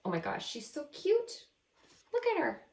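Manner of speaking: ordinary talk, nothing marked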